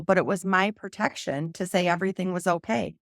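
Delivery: ordinary delivery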